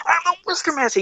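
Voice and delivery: strained, high-pitched movie hero imitation; Wizard Master. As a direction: escalate into a high, hammed-up declaration. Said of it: "you remember, he's".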